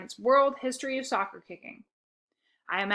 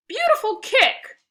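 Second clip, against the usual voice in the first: high, slow, loud praise; shouting; 13 semitones higher, 10 dB louder, slower than usual. A narrator's reading. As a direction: shout it across the pitch. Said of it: "shouted our coach".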